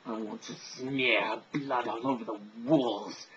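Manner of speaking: put-on slow, sinister character accent; menacing put-on accent, slow; unique book-character accent. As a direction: draw it out in a strange accented voice. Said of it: "unique, uh, accent".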